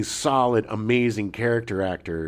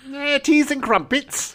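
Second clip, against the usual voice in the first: camp up a fake English toff; mock English accent; slower than usual. Host teasing the actor's fake accent, in mock English. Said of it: "the Cockney accent".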